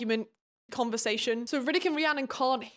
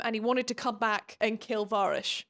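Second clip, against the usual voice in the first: ordinary talk, nothing marked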